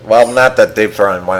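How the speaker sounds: ordinary delivery, nothing marked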